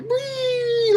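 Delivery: high-pitched imitation of sliding glee; mock gleeful whee. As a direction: squeal as if riding down a slide. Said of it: "like it's a big old slide".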